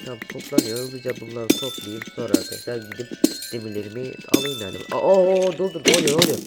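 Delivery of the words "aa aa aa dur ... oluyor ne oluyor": loud, high panicked cries; panicked yelling. Real, not acted. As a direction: yelp in sudden fright. Sudden danger, panicked.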